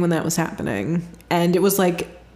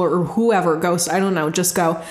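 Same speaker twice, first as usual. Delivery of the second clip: ordinary talk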